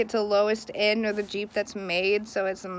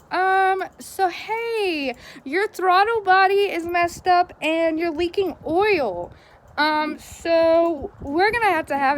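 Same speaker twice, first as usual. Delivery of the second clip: higher-pitched, drawn-out mock phone manner; imitating shop caller; 7 semitones higher, 4 dB louder than usual. Shop calling a customer with bad car news, mock awkward caller.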